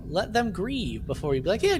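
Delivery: ordinary delivery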